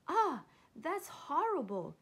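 loud, high cry of disgust; shouted disgust. Acted. Warthog coughing on fire water, shouting in disgust. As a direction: exclaim it in storybook horror. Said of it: "he shouted".

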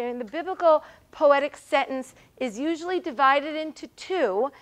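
ordinary delivery

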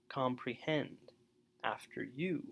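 "comprehend" and "after you" weighted on HEND and YOU; anapest stress. Shown as a demonstration. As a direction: land on the last syllable of "comprehend" and on "you". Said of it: "So you can hear".

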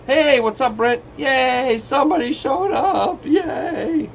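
high, drawn-out playful cheer; excited greeting. Real, not acted. Streamer greets a viewer who showed up, excited. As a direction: squeal a slow, giggly hooray.

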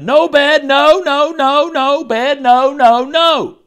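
high, loud string of refusals; bedtime tantrum. A narrator's reading. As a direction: refuse loudly like a child in a tantrum.

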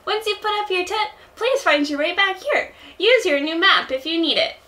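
high-pitched, eager helper voice; little shop helper. Acted. Explaining tent placement, high helper voice. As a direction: chirp the instructions up high. Plays Timmy or Tommy.

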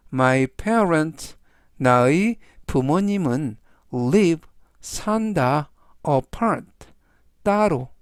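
slow, deliberate model reading; slow word by word. Shown as a demonstration. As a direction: read it slowly, phrase by phrase, for learners. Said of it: "즉, 전체적 의미는".

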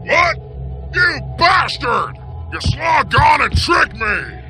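furious villain taunting at full voice; outraged outlaw shout. Acted. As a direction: yell threats at the one who tricked you. Tricked outlaw threatens the stranger.

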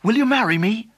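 high, loud, breathless-with-joy announcement; excited cry. Acted. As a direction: burst out with great news, then propose. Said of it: "cried Dick".

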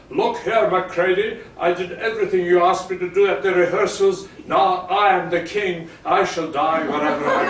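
loud, fast, defiant declaration; at the top of his voice. Retold or imitated. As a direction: bellow it back at the star. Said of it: "at the top of his voice".

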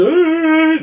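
loud, high-pitched mock bawling; exaggerated crying wail, held long. Shown as a demonstration. Vlogger showing how he did not cry in Carrefour, mock wail. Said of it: "Lloré en el Carrefour, no me puse".

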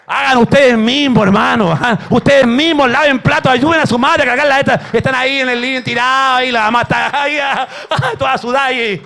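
loud, high preaching at full force; shouting sermon climax; fiery preaching. Acted. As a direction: preach it out to the whole church.

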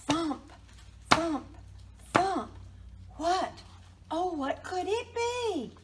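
loud, high storybook alarm; suspenseful thumping. A narrator's reading. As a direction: pound the knocks, then ask in alarm. Knocking builds, mouse wonders aloud.

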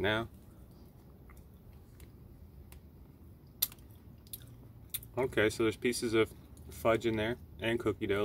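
speaking between bites; talking while eating. Real, not acted. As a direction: mouth partly full. Seen 1: Spoonfuls of ice cream held at lips and taken throughout.

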